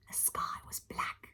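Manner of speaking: whispered, ominous; spooky whisper. Acted. Reading the dark chapter opening to children, ominous whisper. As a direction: breathe it out like a secret.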